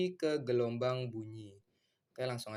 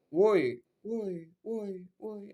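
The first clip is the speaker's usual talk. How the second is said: imitated trailing reverberation; 8 semitones higher, much slower than usual. Shown as a demonstration. Teacher mimics sound following straight after his shout, fading echo. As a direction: call out, then let each repeat die away. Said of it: "terus ada lanjutannya itu".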